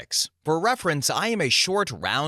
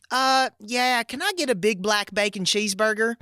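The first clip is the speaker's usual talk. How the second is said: flustered Southern order, dazed; thick Southern accent; 10 semitones higher than usual. Retold or imitated. Starstruck woman ordering at Wendy's in thick Southern accent. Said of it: "in my thick-ass Southern accent".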